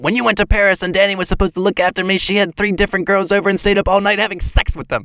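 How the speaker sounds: fast, tumbling blurt of a secret; rushed blurt. Acted. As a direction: rattle it all out in one breath. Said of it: "Kip had spoken so quickly he struggled to get the words out".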